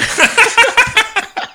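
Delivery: squealing burst of laughter; high laugh. Real, not acted. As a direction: let out a shrill laugh. Laughing at the doctor story.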